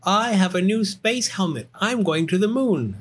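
high, boastful bear cub; excited Little Bear. Acted. Little Bear telling Mother about the moon, high and excited. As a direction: announce it as a thrilled young bear.

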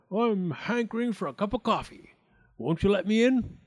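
sly wolf angling for an invitation; smiling wolf. A narrator's reading. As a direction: coax your way in with a smile. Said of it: "smiled the wolf".

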